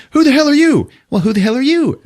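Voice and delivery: high, loud mock demand; mock shout. Podcaster voicing Treat and Xenia facing off, mock shouting. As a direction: bark the question back and forth. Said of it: "He's like".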